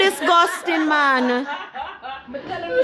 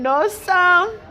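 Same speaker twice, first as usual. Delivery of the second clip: normal talking voice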